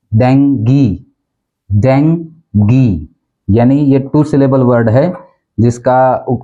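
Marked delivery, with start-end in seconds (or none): 0.1-3.1 डेंगी as DENG-gee; डेंगी in two careful syllables, ending in "ee"; correct pronunciation. Shown as a demonstration. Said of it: "इसकी जो pronunciation है".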